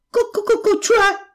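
high-pitched rooster clucking into a command; trumpeting rooster. A narrator's reading. Rooster ordering the monster out, crowing like a trumpet. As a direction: crow it out like a trumpet. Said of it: "公鸡像喇叭一样叫着".

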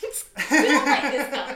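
a bright laugh breaking into the question. Real, not acted. Host teasing the guest about having no Instagram, laughing. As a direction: laugh as you ask it, loud and slow.

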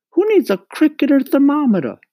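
high, loud scolding mother; scolding mama. A narrator's reading. Mama tells Mario off over crickets, scolding. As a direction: nag at a high pitch, emphatically.

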